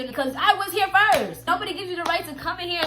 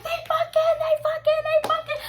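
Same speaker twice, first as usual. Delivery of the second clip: imitating shocked friend; 12 semitones higher than usual.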